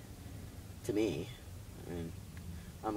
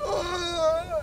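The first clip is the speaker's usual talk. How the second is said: sudden high fake-pain yelp; hammed-up cry of fake injury; mock dive yell; 3 dB louder than usual. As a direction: yelp like a faking soccer player who was shot. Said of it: "it's like someone shot them".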